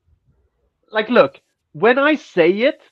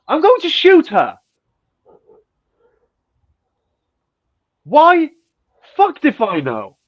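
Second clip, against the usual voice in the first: mock outburst; 9 semitones higher, 4 dB louder than usual. Absurd punchline about Tinder date.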